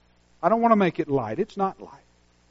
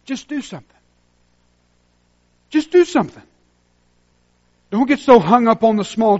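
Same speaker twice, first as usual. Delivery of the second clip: rising preacher's urging, louder; urgent exhortation; 5 semitones higher, 6 dB louder than usual. Real, not acted. Pastor urging the congregation to just act, building. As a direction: push them to act, building.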